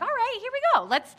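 high, loud eager cheer; excited. Retold or imitated. Couple cheering for month two, high excited. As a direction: pump yourselves up, high and bright. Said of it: "We're like".

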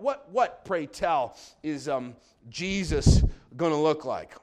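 normal talking voice

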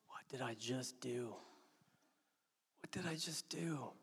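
whispered, hushed horror; stunned whisper. Acted. Peter realizing his betrayal, a stunned whisper. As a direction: breathe it out, barely audible.

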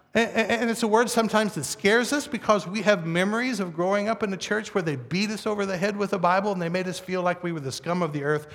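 usual speaking voice

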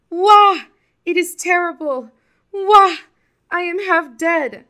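very high, very loud wails; wailing in pain. A narrator's reading. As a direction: howl and lament after the mauling. Buki comes home mauled by tiger.

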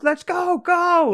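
high, loud panicked shout; yelling to flee. Retold or imitated. Friends yelling to flee the store, loud and high. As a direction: yell to bolt out the door. Fleeing after spitting on door.

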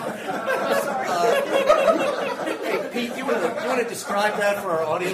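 loud, high, laughing request; laughing tease. Real, not acted. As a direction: egg the storyteller on through laughter. Teases storyteller amid roaring laughter.

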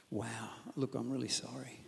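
quiet, careful sorry to the wife; hushed apology. Acted. Husband meekly apologising to his wife, hushed. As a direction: back down softly, almost under the breath. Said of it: "First response is".

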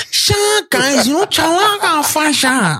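high, hammed-up Zimbabwean accent; Danai. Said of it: "like, full Zim accent".

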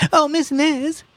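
high New Orleans call; New Orleans accent. Acted. Mrs. Riley calling the saleslady, New Orleans accent. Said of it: "called that accent that occurs".

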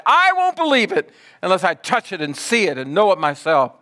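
high, insistent, mounting protest; stubborn refusal. Acted. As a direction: refuse emphatically as doubting Thomas. Acts out Thomas's stubborn refusal.

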